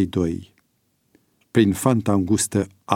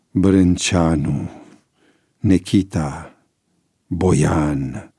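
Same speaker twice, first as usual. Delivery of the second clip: slow, low listing of names; sombre roll-call; 4 semitones lower, much slower than usual. A narrator's reading. Horrified George under his pillow naming the victims, sombre. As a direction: dwell heavily on each name.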